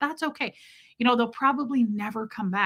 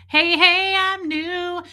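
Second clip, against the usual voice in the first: cute newcomer voice; 9 semitones higher, 6 dB louder, slower than usual. Pretend. Voicing a new viewer.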